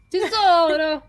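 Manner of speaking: high, loud mock endearment; hammed-up effusive call; gushing greeting imitation. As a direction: stretch it into an over-the-top hello.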